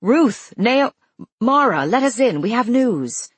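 loud, high call through a door. A narrator's reading. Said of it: "Muffled voices call".

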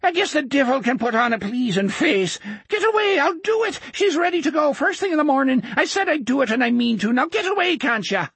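put-on high, wavering old man, scared and blustering; frightened old man. A narrator's reading. Old depot keeper warding off Jack, scared bluster. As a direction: bluster at him from pure fear.

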